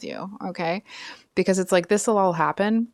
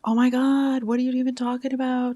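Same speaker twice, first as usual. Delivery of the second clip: high-pitched put-on disbelieving voice; mock attitude; 7 semitones higher than usual. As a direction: imitate an affronted rival with attitude. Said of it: "I'm catching an attitude".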